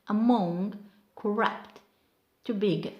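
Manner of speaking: a-MONG, cor-RUPT, be-GIN; second-syllable stress. Shown as a demonstration. Teacher modelling verbs and prepositions, second syllable stressed. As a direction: punch the last syllable of AMONG, CORRUPT, BEGIN. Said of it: "stress pattern on the second syllable".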